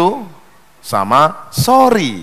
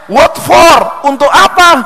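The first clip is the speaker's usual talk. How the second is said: puzzled boss; 9 semitones higher, 6 dB louder, slower than usual. Acted. Boss asks puzzled in joke.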